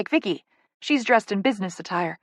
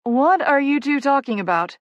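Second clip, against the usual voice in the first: mildly puzzled, higher-pitched question; confused; 6 semitones higher, 4 dB louder than usual. A narrator's reading. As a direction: ask as if you've missed something. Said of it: "clearly confused".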